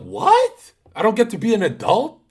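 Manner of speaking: hammed-up high-pitched whine; shocked squeal. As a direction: squeak out the disbelief. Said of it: "I'm like".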